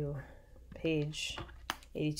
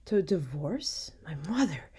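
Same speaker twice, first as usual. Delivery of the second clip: incredulous, swooping rise on the question; shocked cry. A narrator's reading. Mother and daughter learn of the divorce, shocked cry. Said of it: "cried Hippolyta and Matilda both at once".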